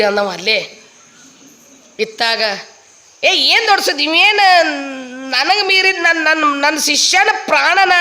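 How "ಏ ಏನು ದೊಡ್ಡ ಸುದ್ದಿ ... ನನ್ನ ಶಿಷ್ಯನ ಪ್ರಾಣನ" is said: high-pitched, emphatic mock bluster; blustering guru. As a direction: boast with a show of authority. Guru boasts before mourners. (ಗುರುವಿನ ಆರ್ಭಟದ ನಟನೆ)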